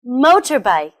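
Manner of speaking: Motorbike as one word, MO punched and falling away; stress on first syllable. Shown as a demonstration. Teacher modelling for learners to repeat, stress on MO in Motorbike. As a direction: hit the MO of Motorbike. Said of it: "stress is on the first syllable".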